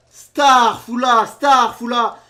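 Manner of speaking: high, very loud calling out; shouted call. Pretend. Announcing the cuber's start, shouted call. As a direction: belt each word like an announcement.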